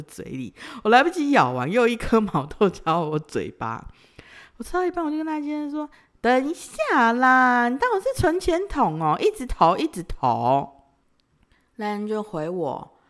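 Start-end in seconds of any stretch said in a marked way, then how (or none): 6.2-10.8 high, drawn-out playful protest; mock complaint. Complaining to boyfriend stuffing her mouth, playful whine. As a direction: whine playfully at him to stop.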